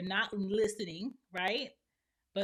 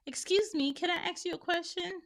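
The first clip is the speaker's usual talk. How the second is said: high, mimicking interrupter; mock polite asker; 10 semitones higher, 3 dB louder than usual. Person interrupting to ask a question, high mock politeness. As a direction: imitate an over-polite asker, pitched way up.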